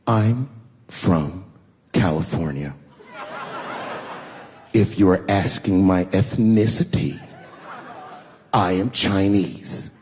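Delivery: slow, low, deliberate condescension; condescending. Retold or imitated. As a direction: spell it out slowly, as if talking down. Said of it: "very condescendingly".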